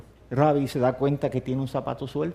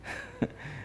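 high laugh; 13 dB quieter than usual. Acted. Laugh during retold risk.

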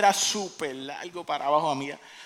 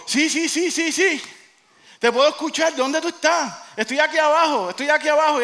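high, loud, eager shouting; excited calling out; 10 semitones higher, 8 dB louder than usual. Acted. Jack to the unseen voice above, shouting excitedly. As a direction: call up frantically to be found.

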